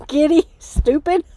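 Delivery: words breaking into tired giggles; sleep-deprived and punchy, laughing through it; slaphappy giggly; giddy giggles. Real, not acted. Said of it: "I'm a little, little, um, punchy".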